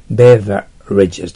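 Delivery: beverages said slowly and firmly, broken into parts with a clear v; slow, loud beverages, first syllable punched; demonstrated pronunciation. Shown as a demonstration. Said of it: "と発音します".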